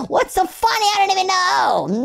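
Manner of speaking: very high-pitched voice straight out of laughter; high, giggly outburst; giddy squeal. Real, not acted. Laughing without knowing why.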